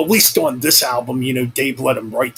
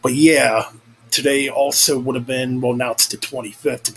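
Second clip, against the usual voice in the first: ordinary delivery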